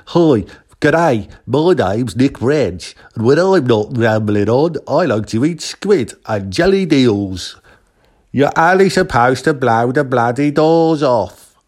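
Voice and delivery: lightly put-on Australian voice; mock Australian accent. Guest mocking friend Nick in a put-on Australian accent. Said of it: "special of Nick just rambling on".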